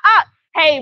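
usual speaking voice

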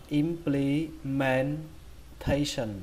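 "implementation" slow, broken into syllables; drawn-out teaching model of "implementation". Shown as a demonstration. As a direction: spell out "implementation" sound by sound. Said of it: "Các bạn đọc là".

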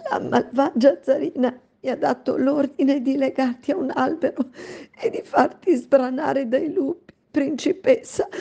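high, broken, tearful maid's voice; sobbing. Acted. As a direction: sob out the cruel order. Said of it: "singhiozzò la donna".

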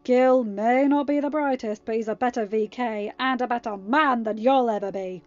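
loud, forceful retort; snarled defence. A narrator's reading. Harry defending his friend Gil, snarled. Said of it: "Harry snarled".

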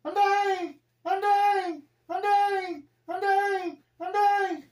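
squeaky falsetto wail, very high and loud; tiny high-pitched cockroach cries of dying. Pretend. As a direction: shriek like a crushed roach. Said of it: "That's a cockroach".

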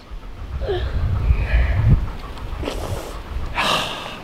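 drawn-out breathy sigh; soft, lengthy exhale; long sigh. Real, not acted. Parent sighing over upset child.

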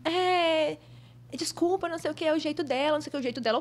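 lightly imitated assistant, starting high and easing down; apologetic assistant. Assistant excusing the rude producer, lightly mimicked high apology. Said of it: "a assistente dela chegou assim".

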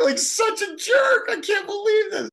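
very high, loud outburst; indignant disbelief. Retold or imitated. Storyteller on the callous acquaintance, loudly incredulous. Exclaims at the man's callous remark.

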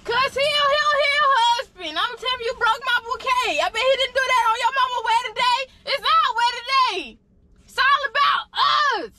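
high-pitched, insistent yelling. Real, not acted. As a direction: yell it in indignant protest. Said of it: "Can you stop yelling?".

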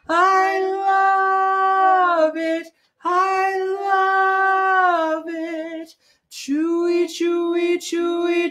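smooth, slow sung chant; legato. Shown as a demonstration. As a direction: stretch the notes smoothly together. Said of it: "nice smooth voice".

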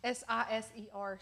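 ordinary delivery

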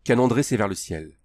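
ordinary delivery, nothing marked

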